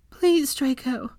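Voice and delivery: high, slow, begging plea; a lightly voiced woman pleading; pleading Hermione. A narrator's reading. Hermione begs Draco at his bedside, pleading high. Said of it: "she begged in the warm, slightly husky voice".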